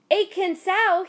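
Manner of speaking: high, loud urgent call. A narrator's reading. Mister Yi stopping a woman at the river, urgent call. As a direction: catch her attention before she jumps. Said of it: "he said".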